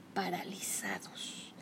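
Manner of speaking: dropped to a whisper. A narrator's reading. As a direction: breathe the word out. Hushed narrative effect.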